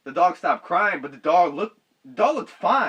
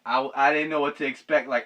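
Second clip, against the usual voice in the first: normal talking voice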